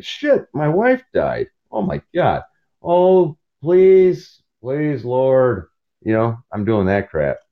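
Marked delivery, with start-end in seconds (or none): 0.0-5.7 high, hammed-up pleading to the Lord; mock despair. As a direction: beg the Lord in mock panic. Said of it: "It's like".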